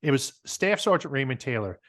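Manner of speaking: normal talking voice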